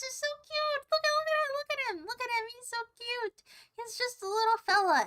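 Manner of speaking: high cutesy coo. Real, not acted. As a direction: gush over something adorable. Cooing over a cute drawing.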